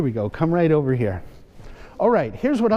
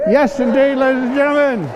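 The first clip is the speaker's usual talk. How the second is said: showman announcement; 8 semitones higher, 4 dB louder than usual. Acted. Magician triumphantly announcing the dog.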